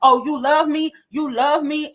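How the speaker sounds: mock her like you can't believe it; mocking disbelief. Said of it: "And you like".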